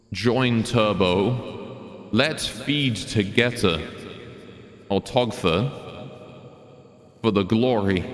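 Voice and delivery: slow, echoing announcement; drawn out through an echo effect; echo mic voice. Real, not acted. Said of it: "The Echo Mic has been invoked".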